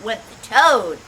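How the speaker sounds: ordinary delivery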